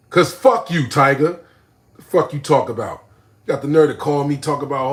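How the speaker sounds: loud angry outburst. Real, not acted. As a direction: cuss him out loud.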